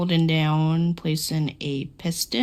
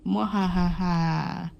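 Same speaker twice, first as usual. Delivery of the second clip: mad scientist laugh; 2 semitones lower, 3 dB quieter, much slower than usual. Pretend. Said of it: "we're so evil".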